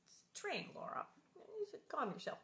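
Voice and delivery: teasing hushed reassurance; hushed mock soothing. Real, not acted. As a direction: gently tell her to calm down. Soothing Laura mockingly.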